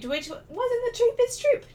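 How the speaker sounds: high, slow ironic squeak; high mock voice. Ironic remark about cost.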